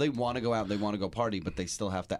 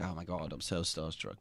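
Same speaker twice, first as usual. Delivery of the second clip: put-on low, soft, half-hearted Australian accent; weak mock Australian accent; failed Australian accent; 5 semitones lower, 8 dB quieter than usual. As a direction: barely attempt an Australian fangirl. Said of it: "That's not even an accent again".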